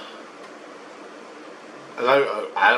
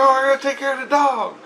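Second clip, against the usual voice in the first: high-pitched retold protest; 13 semitones higher than usual. Husband argues to stay with dog, high protest. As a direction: argue back in a raised pitch.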